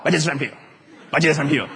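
quick, run-together rote recital; reciting a formula. Acted. Showing how not to confess it, mechanical rote. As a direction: mumble through it like a formula. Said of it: "It's not a formula".

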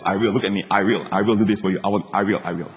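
low, soft, repeated promise; God. Acted. Grace making you look at God, low steady promise. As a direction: reassure in a low, steady undertone. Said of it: "God says".

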